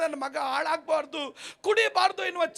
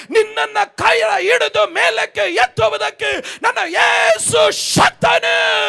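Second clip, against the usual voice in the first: fiery pastor exhorting the crowd; full-force preaching; 11 dB louder than usual. Acted. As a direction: thunder it to the crowd.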